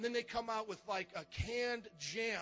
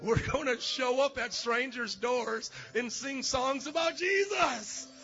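excited; 3 semitones higher, 6 dB louder than usual. Real, not acted. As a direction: savor each word, rising up. Excited caroling announcement.

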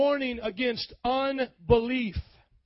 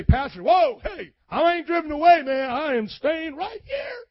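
loud, high protesting churchgoer; a hammed-up loud member insisting he stays; defiant shout; 6 semitones higher, 6 dB louder than usual. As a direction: shout it back at the pastor, proud and sure. Congregant protesting to the pastor.

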